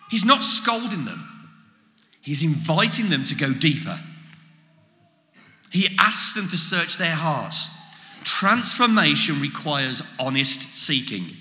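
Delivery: ordinary talk, nothing marked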